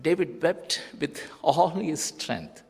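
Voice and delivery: normal talking voice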